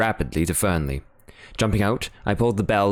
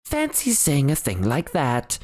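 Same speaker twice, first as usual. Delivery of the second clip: higher, mildly scandalised butler voice; shocked butler; 8 semitones higher than usual. Acted. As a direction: tut at the idea. Parker continues shocked.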